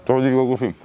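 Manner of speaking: speaking between sandwich bites; chewing while talking. Real, not acted. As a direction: mouth partly full of bread. Seen 1: Both men eat sandwiches; speaker unclear, mouths busy, chewing visible.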